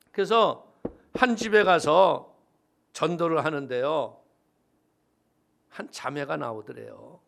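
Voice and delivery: ordinary talk, nothing marked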